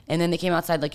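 usual speaking voice

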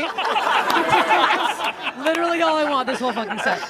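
usual speaking voice

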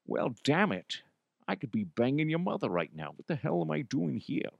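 soft, over-exaggerated star impression; Sean Connery. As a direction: overindulge the cadence, keep it quiet. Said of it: "me trying to do Connery".

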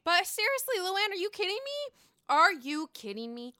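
high, loud disbelieving call-out; incredulous. Real, not acted. Vlogger challenging Luann's hypocrisy, high and incredulous. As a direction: demand it in disbelief.

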